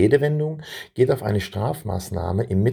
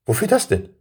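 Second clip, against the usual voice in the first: much higher, shocked question; surprised; 11 semitones higher, 4 dB louder than usual. A narrator's reading. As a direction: ask in disbelief. Boy shocked by bridge.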